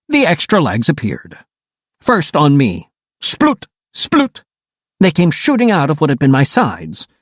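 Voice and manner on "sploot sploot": high squelchy pop, twice; sploot sound effect. A narrator's reading. Narrator pops out a high sploot sound. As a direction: spit out the sound of legs bursting.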